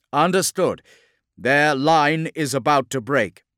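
high, loud officer's voice; battle vox reply. Acted. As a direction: report back forcefully over the fighting. Lieutenant replies over battle vox.

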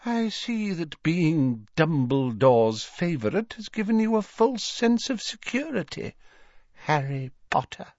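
high-pitched, silky menace; smiling, haughty woman's voice, pitched high; unpleasant smile. A narrator's reading. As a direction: threaten through an unpleasant smile. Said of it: "Narcissa Malfoy smiled unpleasantly".

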